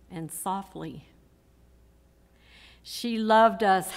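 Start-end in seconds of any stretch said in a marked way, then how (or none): 0.1-1.1 hushed, lower drop in volume; soft. Real, not acted. Daughter recalls how her mother loved them, softly. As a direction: let the words fall away softly. Eulogy voices the word softly.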